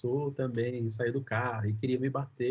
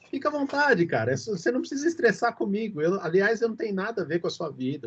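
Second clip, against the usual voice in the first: high, louder dismissive protest; retold exasperated brush-off; 6 semitones higher, 3 dB louder, faster than usual. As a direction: wave the other person off, higher and emphatic. Retold brush-off ending the parking argument. (dispensa exasperada, recontada)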